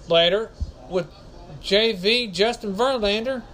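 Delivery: ordinary talk, nothing marked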